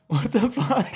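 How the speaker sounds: high, loud startled what the fuck; shocked yell. Retold or imitated. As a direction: blurt it out in shock at the cop car. Shocked at cop chase.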